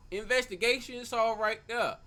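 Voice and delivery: loud, drawn-out cheer that the case is cracked; hyped up. Real, not acted. Streamer loudly declaring the case solved, hyped. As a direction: slam it home like you just solved it.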